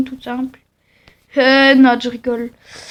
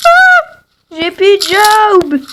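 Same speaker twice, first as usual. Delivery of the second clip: shrieking, then slower wail; high pained cry; 7 semitones higher, 5 dB louder, slower than usual. Acted. Character cries out in pain.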